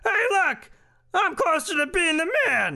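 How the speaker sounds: very high-pitched goofy politician. Acted. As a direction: squeal it way up high. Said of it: "He's like".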